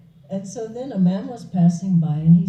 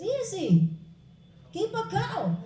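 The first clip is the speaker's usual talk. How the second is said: breezy passer-by; 12 semitones higher, 4 dB quieter than usual. Acted. Passer-by offers easy fix.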